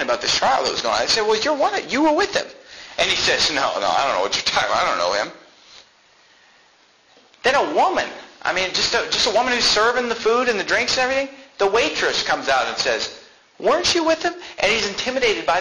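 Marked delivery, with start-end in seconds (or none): 1.2-2.5 high insistent accusation; accusing bystander. Retold or imitated. Bystanders at the fire pressing Peter, high and insistent. 3.5-5.3 low, fast, hurried brush-off; nervous denial. Retold or imitated. Peter to the bystanders, hurried low denial. Peter denying Jesus hastily. 13.6-14.3 high pointed question; a sharp high challenge; accusing waitress. Retold or imitated. Waitress to Peter at the fire, high accusing question.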